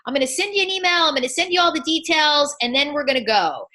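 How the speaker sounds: fast, high, bubbly and eager; excited rush. Retold or imitated. Coach imitates herself rushing a client, excited and fast. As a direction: gush the plans out in one excited breath. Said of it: "We get excited".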